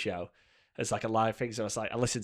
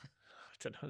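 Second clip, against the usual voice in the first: normal talking voice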